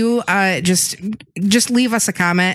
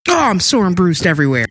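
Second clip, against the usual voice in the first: normal talking voice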